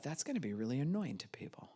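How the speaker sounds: hushed inner whisper; a private aside under the breath; whispered thought. Retold or imitated. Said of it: "at first I thought".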